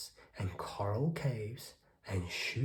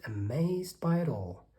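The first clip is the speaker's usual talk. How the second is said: ordinary delivery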